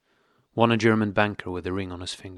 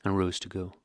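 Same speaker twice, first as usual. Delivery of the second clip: usual speaking voice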